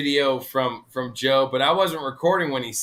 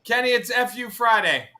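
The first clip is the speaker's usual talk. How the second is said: excited call-out; 11 semitones higher, 5 dB louder than usual. Real, not acted. Calling out to Kenny.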